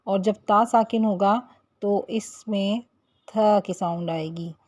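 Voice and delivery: usual speaking voice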